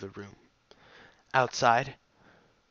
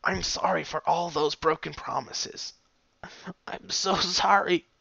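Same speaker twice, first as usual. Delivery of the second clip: tearful; 7 semitones higher than usual. A narrator's reading. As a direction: sob the apology out. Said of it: "one tear chased another".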